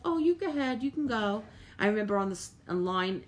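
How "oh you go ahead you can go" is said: high-pitched, kindly retold quote; sweetly generous. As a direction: imitate yourself giving way sweetly, pitched up. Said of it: "instead of ... being so angry and mean".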